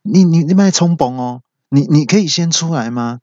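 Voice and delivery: high, flustered pleading; nervous guard. Retold or imitated. Security guard pleads with man at elevator, nervous. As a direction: play a jumpy guard talking someone down. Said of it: "保全就也很紧张".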